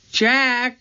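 high shout of his name; loud cry. A narrator's reading. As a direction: shout for help. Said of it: "Her voice was louder".

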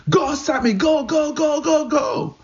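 high excited yell of encouragement; shouting. Retold or imitated. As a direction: cheer him on at full voice. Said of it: "shouting at the top of my lungs".

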